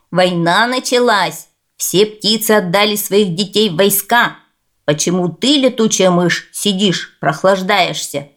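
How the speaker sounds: usual speaking voice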